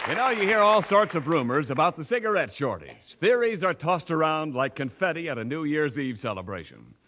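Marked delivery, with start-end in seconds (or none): none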